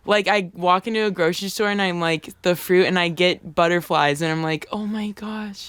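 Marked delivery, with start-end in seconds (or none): 4.7-5.7 soft awestruck gasp; hushed awe. Retold or imitated. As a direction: breathe it out in wonder. Awed at fruit aisle.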